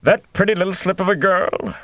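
high, disbelieving exclamation; incredulous exclaim. A narrator's reading. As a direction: scoff in amused disbelief. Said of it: "the Gleeman exclaimed".

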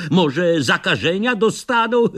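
usual speaking voice

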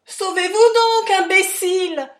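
very high, very loud desperate yell; yelled warning. A narrator's reading. Charlie yelling to Harry to flee while wrestling. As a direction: scream at him to run.

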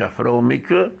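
usual speaking voice

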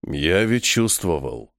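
low, slow resignation; resigned. A narrator's reading. Vangur admits he sensed it coming, resigned. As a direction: say it as one who saw it coming. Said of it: "обречённо произнеся".

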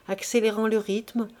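usual speaking voice

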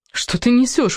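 high-pitched outraged protest; desperate cry. Acted. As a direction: cry out in despair, pitch jumping up. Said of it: "в отчаянии закричала я". (отчаянный высокий выкрик)

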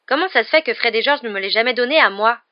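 loud, high indignant protest; scandalised. A narrator's reading. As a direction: protest at being unfairly left out. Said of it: "dit Ron scandalisé".

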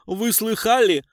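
high, loud amazed call to his mates; stunned outcry. A narrator's reading. Tramp turning to his pals, loud and amazed. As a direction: play the shock up to the room. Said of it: "Лохматый ошарашенно обернулся".

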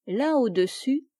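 plain reading voice

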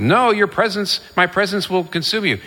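high-pitched retold refusal; arguing back. God answering Moses's plea, arguing back. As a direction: act out an insistent rebuttal. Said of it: "gets into an argument with God".

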